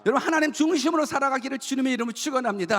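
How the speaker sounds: rapid, high-pitched benediction; preached blessing. Real, not acted. Pastor blessing the congregation in the Lord's name, impassioned preaching. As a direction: pronounce a blessing in full preaching voice.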